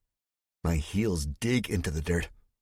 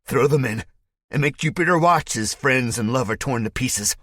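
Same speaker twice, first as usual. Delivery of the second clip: loud, higher shouted command; gleeful order; 6 semitones higher, 6 dB louder than usual. Acted. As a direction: order the execution with relish. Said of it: "Spike says".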